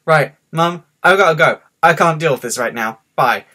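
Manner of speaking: loud, fed-up sign-off; exasperated. Retold or imitated. Child hanging up on mum over stuck drawer, exasperated. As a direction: cut the call short, loud and exasperated.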